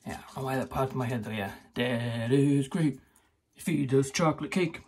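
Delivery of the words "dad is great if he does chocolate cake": lightly voiced, slowed-down Cosby line; Bill Cosby quote. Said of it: "Bill Cosby, I think it was".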